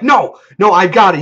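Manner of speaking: loud, high-pitched protest; acted insistence. Player protesting to the storyteller, loud acted insistence.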